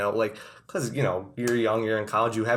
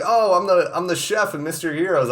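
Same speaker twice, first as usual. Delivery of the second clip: higher, louder proud introduction; proud chef; 7 semitones higher, 5 dB louder than usual. Retold or imitated. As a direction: puff up like the head chef. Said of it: "He's like".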